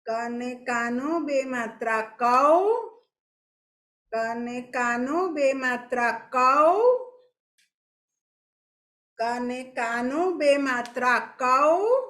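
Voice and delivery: ક અને કાનો બે માત્રા કૌ, slow and stretched, rising on કૌ; slow spelling aloud. Shown as a demonstration. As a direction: dictate it slowly to the class, rising on કૌ. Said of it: "બોલી બોલીને ધીરે ધીરે લખો". (બાળકોને ધીમે ધીમે બોલાવતી શિક્ષિકા)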